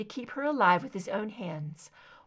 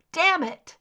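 pained cry; 8 semitones higher, 7 dB louder than usual. Acted. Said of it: "muffled cry of pain".

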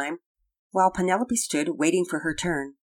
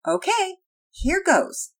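put-on high, louder young Penelope voice; high-voiced "here I go" resolve; girl readying herself; 13 semitones higher, 5 dB louder, slower than usual. A narrator's reading. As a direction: pitch it up, bracing for a try. Character readies herself for attempt.

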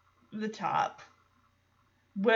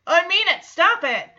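irritated; 7 semitones higher, 8 dB louder than usual. Retold or imitated. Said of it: "Kimmy's getting irritated".

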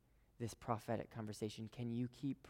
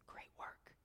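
whisper; 16 dB quieter than usual. Acted. God's tender reply whispered.

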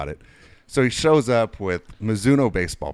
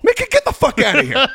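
much higher, laughing through it; laughing umpire; 7 dB louder than usual. Retold or imitated. Umpire laughing off the coach's phone prank. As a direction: crack up while telling him off.

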